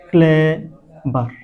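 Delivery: Clever slowly split in two, with a pause between; syllable by syllable. Shown as a demonstration. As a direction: sound out clever as clay, ভার. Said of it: "এটা কিন্তু দুই syllable বিশিষ্ট". (Clever দুই সিলেবলে ধীরে ভেঙে বলা)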